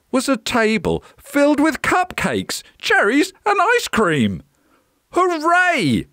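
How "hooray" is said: put-on very high boyish cheer; excited cry. A narrator's reading. Jack sees the feast, high excited cheer. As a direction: cheer like a delighted child. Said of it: "cried Jack".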